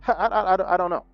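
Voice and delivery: words breaking out of a real laugh; amused, laughing stutter. Real, not acted. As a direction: stammer through the tail of a laugh. Still laughing after own joke.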